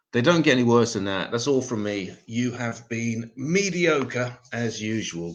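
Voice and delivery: ordinary delivery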